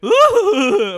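loud high mocking ooh. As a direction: let out a big exaggerated ooh. Imitating his noise.

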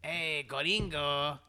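put-on Mexican accent. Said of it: "the Mexican one".

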